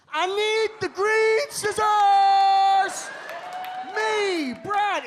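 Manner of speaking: high, slow whiny shout; kid yelling for help. Acted. Left-handed kid shouting across the classroom, whiny and high. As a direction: wail for the scissors like a desperate kid.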